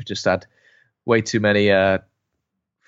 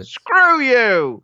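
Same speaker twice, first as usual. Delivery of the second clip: much higher, loud silly voice; mock high retort; 12 semitones higher, 5 dB louder than usual. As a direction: spit it out in a squeaky voice. Acted retort in exchange.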